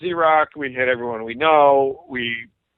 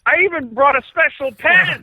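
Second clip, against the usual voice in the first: amused drummer; 11 semitones higher, 3 dB louder than usual. Retold or imitated. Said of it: "Anthony goes".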